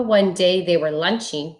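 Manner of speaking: ordinary delivery, nothing marked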